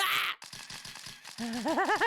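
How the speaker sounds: high strangled scream; cry of dismay. Acted. Photographer reacts to the sneeze, cry of dismay. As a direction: let out a wordless cry of dismay.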